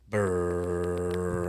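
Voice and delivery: low rumbling brrrr engine noise; engine hum imitation. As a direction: hum like a smooth diesel.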